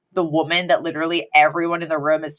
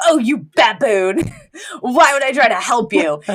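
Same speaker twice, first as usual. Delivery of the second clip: mock disdain; 4 semitones higher than usual. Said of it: "he literally goes".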